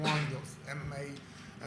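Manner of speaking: ordinary talk, nothing marked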